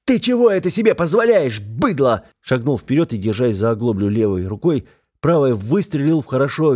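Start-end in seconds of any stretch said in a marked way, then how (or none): 0.0-2.2 high, raised yell at an attacker; outraged shout. A narrator's reading. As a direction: shout him down with indignation. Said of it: "и с криком".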